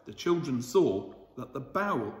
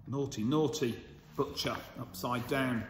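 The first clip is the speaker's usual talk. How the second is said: plain reading voice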